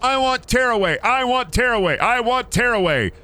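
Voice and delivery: put-on high, whiny chant of a furious kid; kid's tantrum. Boy wailing at his dad in the store, high tantrum. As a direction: demand it over and over like a spoiled child. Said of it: "starts throwing a shit fit".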